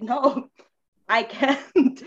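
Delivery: loud, slow retold refusal with a laugh; half-laughing protest, drawn out and loud. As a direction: push back loudly, laughing at the memory. Retelling her refusal to minister.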